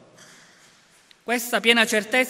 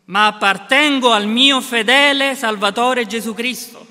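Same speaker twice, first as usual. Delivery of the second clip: loud declaration; 3 semitones higher, 7 dB louder than usual. Acted. Climax of catechism answer.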